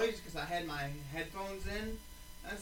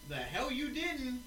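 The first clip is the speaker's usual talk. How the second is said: higher, drawn-out retort; mock insistence; 5 semitones higher, slower than usual. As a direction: insist slowly, as in a retold phone argument. Arguing with dad on phone.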